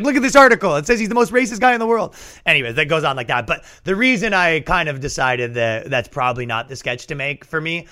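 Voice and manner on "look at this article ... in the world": louder, much higher protest; insistent pleading. Retold or imitated. Recruiter defending his pick to the Grand Wizard, high insistent voice. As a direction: plead your case, pitched up.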